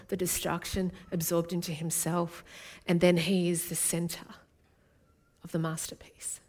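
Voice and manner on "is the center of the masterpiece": hushed whisper. Real, not acted. Preacher to congregation, a hushed whisper. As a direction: drop to a whisper for the climax.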